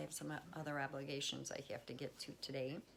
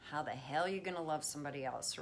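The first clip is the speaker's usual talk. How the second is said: ordinary delivery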